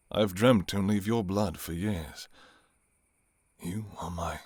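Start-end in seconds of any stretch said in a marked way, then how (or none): 3.6-4.4 whisper. Acted. Confessing craving, intimate.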